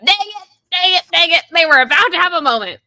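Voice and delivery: high, loud dang it; playful frustration. Real, not acted. Host groaning that the couple got interrupted, high and loud. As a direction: cry out at the ruined moment.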